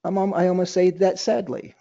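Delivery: ordinary delivery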